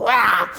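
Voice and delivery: sudden mock holler; short hammed-up roar; imitated prayer shout. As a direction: burst out a wordless prayer yell. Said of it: "소리 지르는 거예요".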